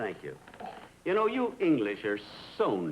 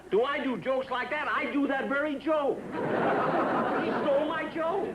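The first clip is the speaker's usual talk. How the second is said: indignant outburst; 9 semitones higher than usual. Real, not acted. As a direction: sputter at the injustice. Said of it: "please be quiet".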